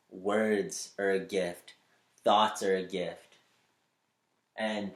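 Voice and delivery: normal talking voice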